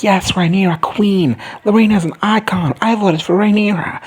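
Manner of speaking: high, excited crowd cheer; cheering fan. Acted. Crowd hailing Rhaenyra as their queen, cheering.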